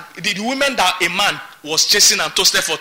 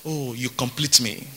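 soft, slow mock-tender line to a spouse; husband gently mocking a sweet nothing, softer and slower; mock romantic murmur; 6 semitones lower, 8 dB quieter, slower than usual. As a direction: croon it softly like a lovesick spouse. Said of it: "would like to tell his spouse".

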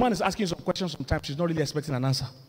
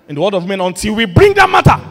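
loud, rising preacher's cry; preaching shout; 4 semitones higher, 13 dB louder than usual. Real, not acted. Pastor preaching to the congregation, loud and rising. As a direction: drive the point home at full voice.

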